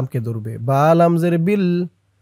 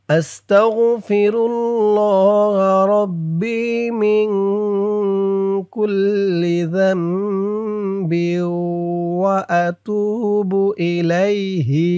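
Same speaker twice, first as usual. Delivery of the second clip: teacher chanting the dua for students to repeat; full recitation; 3 semitones higher, much slower than usual. Shown as a demonstration. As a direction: recite the line in full, each vowel drawn out. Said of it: "আপনারাও আমার সাথে বলে তেমন উচ্চারণ করবেন".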